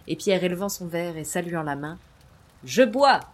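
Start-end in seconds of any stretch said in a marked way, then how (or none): 2.6-3.2 bold, loud toasting voice. Acted. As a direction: raise the glass and call it out. Pierre toasts at the party.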